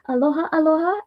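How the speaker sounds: ordinary talk, nothing marked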